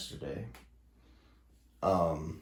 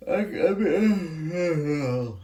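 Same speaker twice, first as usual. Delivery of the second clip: high, loud, drawn-out garbled stammer; tongue-tied; 9 semitones higher, 5 dB louder, much slower than usual. Real, not acted. Vlogger who can't even speak anymore, tongue-tied and exasperated. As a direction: let the words jam into a frustrated noise. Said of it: "I can't even speak anymore".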